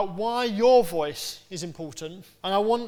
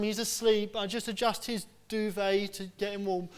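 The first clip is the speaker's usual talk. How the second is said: normal talking voice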